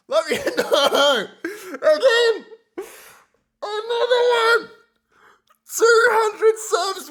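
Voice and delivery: high, loud excited shout; shouting in disbelief. Real, not acted. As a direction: yell it out in shock. Shocked by back-to-back subs.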